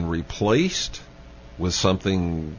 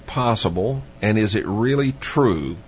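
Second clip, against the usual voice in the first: ordinary talk, nothing marked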